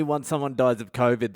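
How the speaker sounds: ordinary talk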